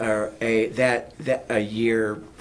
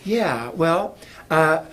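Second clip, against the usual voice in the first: ordinary delivery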